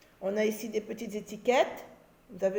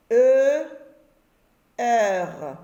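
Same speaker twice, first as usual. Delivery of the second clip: letters called out loudly; 3 semitones higher, 6 dB louder than usual. Shown as a demonstration. Teacher to pupils, calling out letters loudly. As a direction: call out each letter. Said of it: "On reprend les syllabes".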